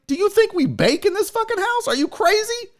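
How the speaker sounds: high-pitched mock disbelief; incredulous retort. Acted argument about food coloring.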